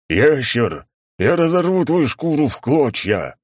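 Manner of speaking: high, loud, slow enraged threat; furious roar. A narrator's reading. Enraged knight threatens lizard.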